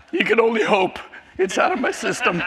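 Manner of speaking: breaking into a laugh through the tears. Real, not acted. Ian joking to the church about his tears, laughing. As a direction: shrug off the emotion with a laugh.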